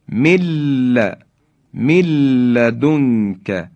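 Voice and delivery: من لدنک as milladunka, l held long; slow demo, drop the n of من before لدنک; doubled lam. Shown as a demonstration. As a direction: stretch the joined l in مل.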